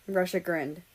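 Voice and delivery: ordinary delivery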